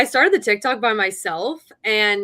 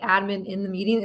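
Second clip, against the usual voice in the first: ordinary delivery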